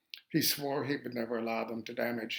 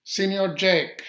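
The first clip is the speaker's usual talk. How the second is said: loud, higher, drawn-out call across the meadow; 7 semitones higher, 11 dB louder, slower than usual. Retold or imitated. Jose calls to Jake outside his cabin, loud high call. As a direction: call his name from far off, slow and loud. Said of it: "Jose called out".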